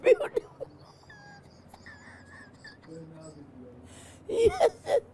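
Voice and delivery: high, giggling echo of the crowd; laughing at the flatterers; laughing mock praise.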